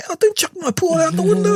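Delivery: high scolding woman's voice; hammed-up high protest; mock scolding woman. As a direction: imitate her telling him off. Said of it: "she'd go".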